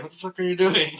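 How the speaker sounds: high, loud mock yell; pissed off. Old man yelling at the elephant, mock angry. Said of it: "getting pissed off at him".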